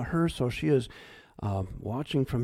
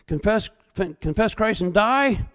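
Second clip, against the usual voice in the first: mock threat; 5 semitones higher, 5 dB louder than usual. Threat quoted at pastors.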